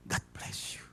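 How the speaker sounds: breathy whispered God bless you. Acted. Husband blessing his wife, in a whisper. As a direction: whisper it tenderly.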